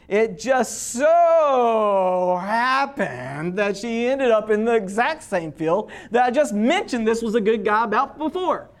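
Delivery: hugely drawn-out "so", playfully overdone coincidence; knowing wink-wink. Acted. Author winking to the reader about Ruth's field, knowing and drawn out. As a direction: stretch it out as if letting them in on the secret. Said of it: "This is a wink, wink from the author".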